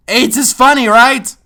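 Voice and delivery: loud mock question; sarcastic prod. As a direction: needle the writers.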